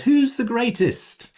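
high, slow boastful question; a touch of a proud disciple asking; voicing the disciples. Preacher voicing disciples, slow high boastful question.

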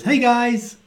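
light high casual hey; jokey, breezy Jesus greeting the crowd. Retold or imitated. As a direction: toss off a breezy hello. Jesus announcing himself, voiced.

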